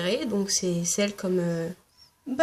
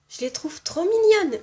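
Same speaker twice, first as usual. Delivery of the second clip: gushing; 8 semitones higher, 3 dB louder than usual. Real, not acted. Gushing over cute hajidere.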